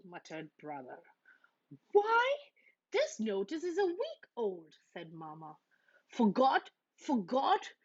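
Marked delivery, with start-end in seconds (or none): 1.9-4.7 high, loud start of dismay; exasperated surprise. Acted. Mama finding the old notice, exasperated. 6.1-7.7 mild roar. A narrator's reading. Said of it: "roared Papa".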